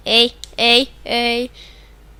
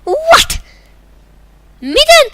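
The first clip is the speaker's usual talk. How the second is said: squeaky disbelieving rise; shocked high what; 7 dB louder, slower than usual. Real, not acted. Gamer shocked at the replay, high surprised squeak. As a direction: jump way up in shock.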